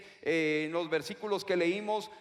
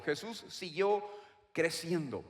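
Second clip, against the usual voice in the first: normal talking voice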